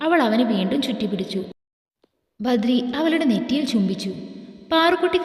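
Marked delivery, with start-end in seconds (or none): none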